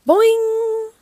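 high, loud drawn-out boing; springy boing. A narrator's reading. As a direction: sound out a spring popping, held high. Story sound effect for surprise gift.

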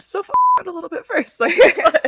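high, fast, laughing. Real, not acted. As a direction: giggle the advice out. Laughing advice to friend.

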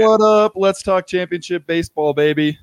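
hyped entrance. Real, not acted.

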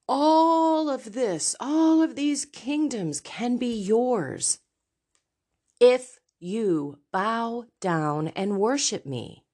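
slow, grand tempter, starting high and settling; Satan tempting. Acted. Satan tempting Jesus in the desert, slow, drawn-out offer. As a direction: stretch out the offer, pausing before the condition. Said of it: "who tempted him and said".

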